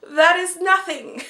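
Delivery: hammed-up scoffing laugh, slow at the end; mock dismissive laugh. Vlogger mocking season one as nothing, scoffing. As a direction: laugh it off like it's trivial. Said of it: "be like".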